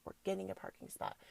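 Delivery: murmured quickly to herself, like a manifesting chant. Retold or imitated. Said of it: "and I'm like".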